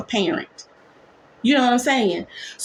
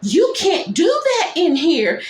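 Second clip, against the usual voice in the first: shocked protest; 6 semitones higher than usual. Retold or imitated. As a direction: call out in shock. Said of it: "Beth Ann is like".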